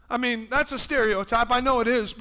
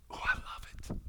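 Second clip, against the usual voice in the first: delighted whisper; 10 dB quieter, faster than usual. Real, not acted. Aside delighting in the verse.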